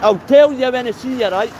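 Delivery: loud, high street shout to a passing mate; calling out. Real, not acted. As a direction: hail him loudly from across the road.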